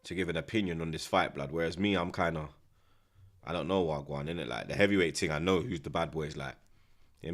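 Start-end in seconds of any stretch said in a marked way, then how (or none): none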